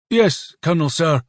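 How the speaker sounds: put-on high-pitched reply with Polish accent; Polish-accented military acknowledgement; foreign accent. A narrator's reading. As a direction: answer in a light Polish accent. Polish officer answers again.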